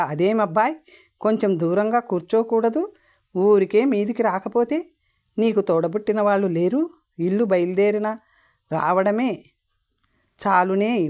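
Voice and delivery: plain reading voice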